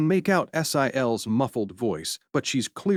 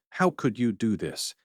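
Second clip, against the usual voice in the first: ordinary delivery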